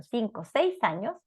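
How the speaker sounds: normal talking voice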